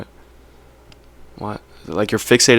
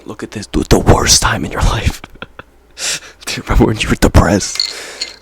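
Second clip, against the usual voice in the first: a creepy little whispered reminder; Snapchat whispering. Retold or imitated. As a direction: whisper it like the app is haunting you. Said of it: "That's what Snapchat whispers to me".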